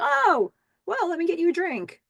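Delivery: very high, hammed-up eagerness; suddenly friendly surprise. Man who was angry offers Billy a drink, suddenly friendly. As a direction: imitate an instant switch to fawning friendliness.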